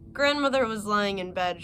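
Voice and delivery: ordinary delivery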